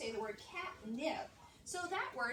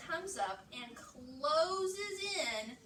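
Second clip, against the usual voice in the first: drawn out; 2 semitones higher, much slower than usual. Shown as a demonstration. Teacher explains letter closing vowel.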